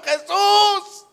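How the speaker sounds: high, loud pleading shout; drawn-out cry at the top of the voice. Acted. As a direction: yell desperately for him to stay. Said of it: "Más gritó".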